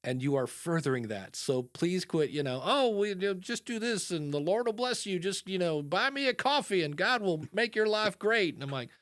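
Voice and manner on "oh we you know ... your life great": put-on higher-pitched Southern televangelist accent, pleading for donations; Southern preacher accent. A radio minister pushing listeners to buy him coffee, in a Southern preacher accent. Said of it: "why Minister Bob has a Southern accent".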